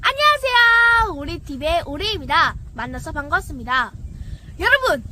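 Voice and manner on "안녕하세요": loud, high, drawn-out hello; excited greeting. Acted. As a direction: greet the viewers big. Channel greeting.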